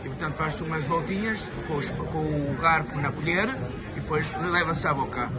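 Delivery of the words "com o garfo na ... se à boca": usual speaking voice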